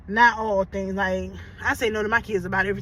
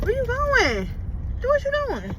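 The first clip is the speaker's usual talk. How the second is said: calling out; 13 semitones higher than usual. Real, not acted. Calling out to someone.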